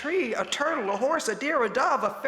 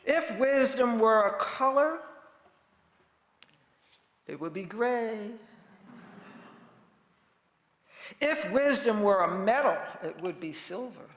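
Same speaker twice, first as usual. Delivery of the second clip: calm gentle wise voice. Acted. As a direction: savor each image gently. Poetic wisdom litany.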